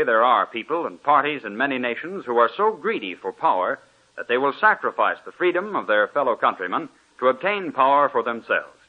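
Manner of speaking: usual speaking voice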